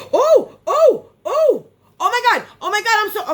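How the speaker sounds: gasping, high and loud oh my God; real excitement. Real, not acted. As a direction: squeal with delight at the box. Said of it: "gets me s-, excited".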